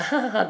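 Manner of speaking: mock nervous ha-ha, trailing away; typical awkward laugh. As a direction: imitate a forced polite laugh, quietly. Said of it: "la típica risa".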